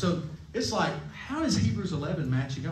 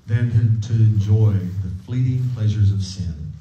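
pious reading; 5 semitones lower, 6 dB louder than usual. Pretend. Preacher reading Hebrews aloud in a mock pious voice. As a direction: lower and louder, solemn scripture voice. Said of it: "so we have to act pious".

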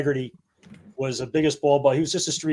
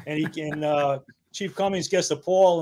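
ordinary delivery

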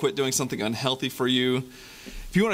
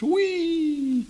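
much higher, joyful swinging cry; gleeful whee. Acted. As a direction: squeal it like a kid on a swing. Boy swings on vine.

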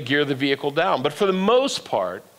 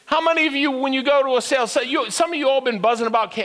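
normal talking voice

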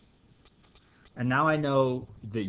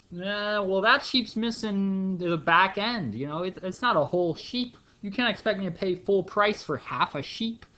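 higher, pleading haggle; mock haggling whine; 7 semitones higher, 3 dB louder than usual. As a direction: talk the price down, half-whining. Buyer haggling over half sheep.

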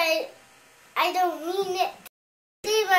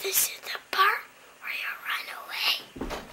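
soft unvoiced whisper; 12 dB quieter than usual. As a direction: breathe it as a secret. Child repeats whispered movie line.

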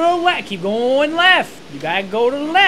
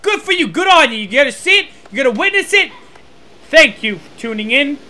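loud, high mock hype for dull gameplay; sarcastic commentator; 3 semitones higher, 4 dB louder, faster than usual. Mocking the game to viewers, sarcastic commentator. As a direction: gush like a hyped-up show host.

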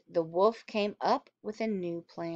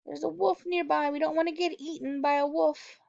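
worried little pig sister; 6 semitones higher than usual. Retold or imitated. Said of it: "the sister saying".

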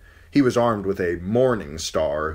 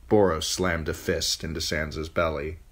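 plain reading voice

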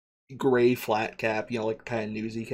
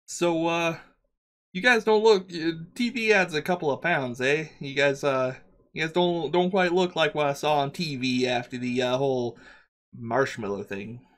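higher-pitched, casual New York worker; MTA worker, curious; 4 semitones higher than usual. Acted. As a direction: size them up chattily and hesitantly. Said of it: "as he goes".

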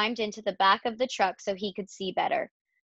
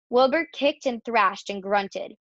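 usual speaking voice

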